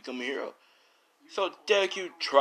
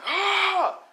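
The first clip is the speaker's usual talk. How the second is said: long, very high drawn-out roar, slow and loud; imitated angry roar; 7 dB louder than usual. Monster roaring right in Emina's face, drawn-out loud roar. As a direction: let the roar rip out slow. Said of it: "he roars in her face like an angry roar".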